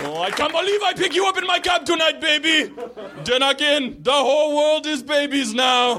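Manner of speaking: put-on high, yelled Jamaican accent; Jamaican cab driver. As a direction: exclaim in Jamaican-accented amazement. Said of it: "I picture Sebastian the Crab and I yell".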